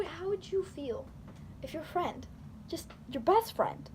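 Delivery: ordinary talk, nothing marked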